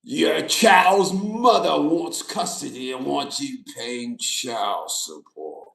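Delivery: slow, low menacing Emperor voice. Retold or imitated. Court talking down to a father, slow low Emperor voice. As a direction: lay down the verdict like a Sith Lord. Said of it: "talking to you like that".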